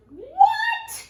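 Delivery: shrieked high, startled granny; high astonished granny cry; cried. Acted. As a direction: squeal it like a shocked old grandma. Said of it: "cried Granny".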